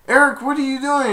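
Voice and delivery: high, loud questioning cry; alarmed shout. Retold or imitated. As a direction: call out in high-pitched disbelief. Servant shouts at the prince.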